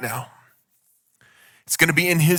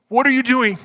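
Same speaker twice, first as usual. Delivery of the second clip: much higher, mock exasperated parent; 9 semitones higher, 3 dB louder than usual. Pastor mimicking himself scolding his son, exasperated. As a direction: fling the question at the kid. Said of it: "with our son".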